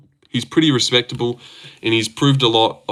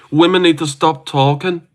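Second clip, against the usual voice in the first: attempted impression; 6 semitones higher, 4 dB louder than usual. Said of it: "I can't do a good one".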